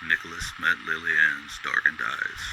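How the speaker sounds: ordinary delivery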